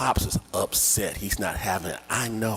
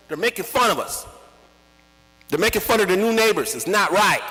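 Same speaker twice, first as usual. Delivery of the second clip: higher, louder indignation; outraged; 9 semitones higher, 5 dB louder, faster than usual. Retold or imitated. Child outraged at the mockery. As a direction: take offense for the neighbors.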